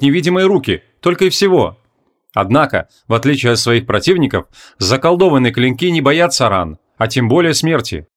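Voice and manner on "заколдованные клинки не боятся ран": ordinary delivery, nothing marked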